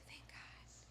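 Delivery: hushed, breathy thanks under her breath; relieved whisper. Retold or imitated. Alone in the ER room, whispering in relief. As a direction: whisper it so nobody in the ER hears. Relief at finding hidden drugs.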